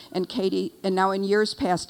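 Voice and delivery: normal talking voice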